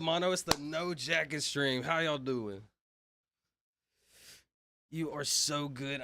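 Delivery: usual speaking voice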